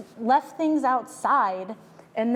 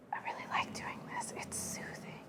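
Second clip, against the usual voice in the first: whisper; 17 dB quieter than usual. Real, not acted. Soothing aside.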